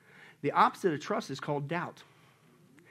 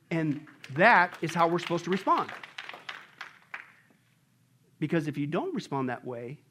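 usual speaking voice

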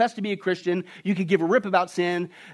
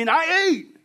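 high, whining excuse; hammed-up Adam whining his excuse; whiny blame; 9 semitones higher than usual. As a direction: push the blame back onto God. Adam blaming God, acted.

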